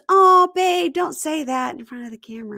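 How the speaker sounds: high, loud, gushing "Oh, babe" that softens off; a hammed-up girlfriend hushing him; mock girlfriend voice. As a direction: imitate her sweet scolding of her boyfriend. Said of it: "She goes".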